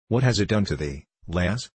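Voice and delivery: usual speaking voice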